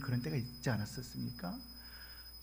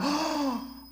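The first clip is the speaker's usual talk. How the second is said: gasp; 11 semitones higher than usual. Retold or imitated. 놀래가지고.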